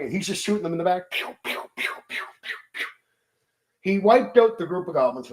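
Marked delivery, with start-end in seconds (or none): 1.1-2.9 softly whispered pew pew volley; breathy toy-gun blasts, rapid; laser gun noise. Retold or imitated. Fighter shooting fleeing goblins in the back, whispered pew pew.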